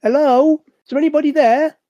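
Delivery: high, loud call of hello into the unknown; a curious call to whoever watches the camera. Pretend. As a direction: sing out up into the lens, loud and high. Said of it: "peer into it and go".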